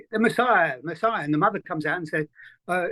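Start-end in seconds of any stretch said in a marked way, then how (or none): none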